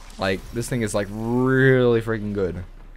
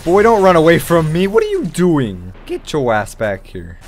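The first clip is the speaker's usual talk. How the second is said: loud, raised-pitch calling out; mock scolding; 4 semitones higher, 7 dB louder than usual. Real, not acted. Gamer calling back an opponent running away, loud scolding. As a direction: yell after a fleeing opponent.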